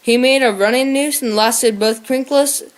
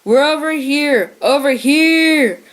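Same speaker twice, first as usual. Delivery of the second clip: cup the paws and yell out to friends; yelling aloud; 4 semitones higher than usual. A narrator's reading. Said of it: "he yelled aloud".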